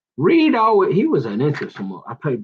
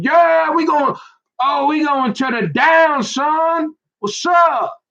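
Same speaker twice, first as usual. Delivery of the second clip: excited shout; 13 semitones higher, slower than usual. Real, not acted. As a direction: yell it out like a hype man.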